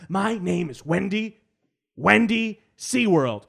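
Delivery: a callous boss announcing herself; greedy boss voice. Acted. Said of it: "she's like".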